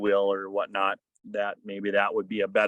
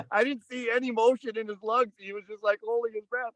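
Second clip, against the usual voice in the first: high laugh, then talk at a much higher pitch; 11 semitones higher than usual. Real, not acted. Telling how his son held his breath, laughing. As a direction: giggle it out high.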